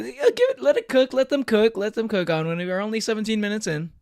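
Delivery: loud, high anime hero voice. Pretend. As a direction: shout it like a shonen protagonist. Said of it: "Stop the voice, please".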